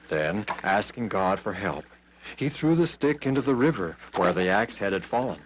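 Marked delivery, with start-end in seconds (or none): none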